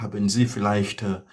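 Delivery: ordinary delivery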